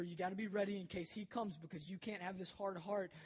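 normal talking voice

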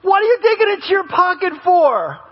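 high, stammered incredulous outburst; acted disbelief. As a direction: play a flabbergasted grown child. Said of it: "you're sitting back going".

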